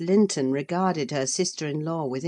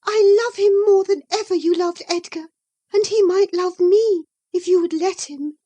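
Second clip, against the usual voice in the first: high, louder, pleading and hurt; impassioned young woman; 14 semitones higher, 8 dB louder than usual. Acted. Isabella insisting to Catherine, high and impassioned. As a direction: pour out the longing.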